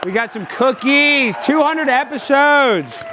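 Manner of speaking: high, stretched-out cheer; excited, slow singsong celebration; celebratory shout-out. Real, not acted. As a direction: crow about the milestone, high and drawn out. Celebrating the milestone show.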